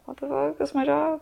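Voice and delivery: high-pitched, incredulous self-quote; lightly acted, high-voiced astonishment; mock disbelief. Quotes own disbelief about job.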